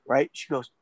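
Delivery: ordinary talk, nothing marked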